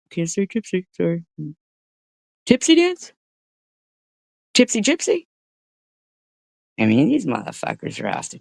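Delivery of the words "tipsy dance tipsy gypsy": much higher, silly joking voice; mock squeaky voice. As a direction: toss off the pun in a goofy high voice. Joking wordplay in put-on voice.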